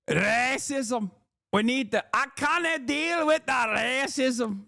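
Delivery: high mocking voice breaking into laughs; mocking impression. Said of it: "that's what she sounds like".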